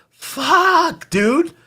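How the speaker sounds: high-pitched exasperated exclamation; exasperated outburst. Real, not acted.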